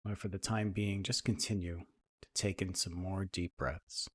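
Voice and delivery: soft, even meditation voice with long pauses; guided meditation. Acted. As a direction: ease listeners into calm, leaving space between phrases.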